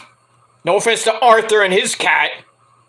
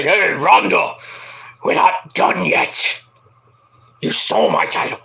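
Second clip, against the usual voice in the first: Becky's wrestling challenge. Acted. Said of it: "Could Becky come out and say".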